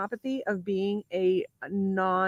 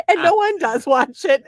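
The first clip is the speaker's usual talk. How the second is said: laughing; 7 semitones higher, 8 dB louder, slower than usual. Real, not acted. Laughing through a joke.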